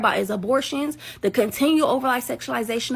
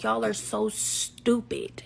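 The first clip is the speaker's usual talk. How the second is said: slow mock put-down; 2 semitones lower, 8 dB quieter, much slower than usual. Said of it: "I know she be like".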